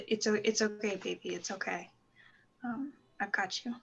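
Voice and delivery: soft, hushed comfort; a hushed father's comfort to his daughter; tender. Acted. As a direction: comfort her quietly.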